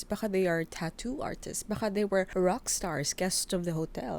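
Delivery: usual speaking voice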